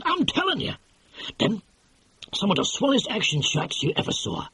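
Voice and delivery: lightly voiced excitable producer; brash film producer. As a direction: sell it like a fast-talking movie boss. Producer raving about footage.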